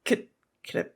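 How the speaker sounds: hesitant, stammering whisper dropping low; whispering nervously to a dog owner; timid whisper. Retold or imitated. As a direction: ask it almost too shy to speak. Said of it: "So I'm like".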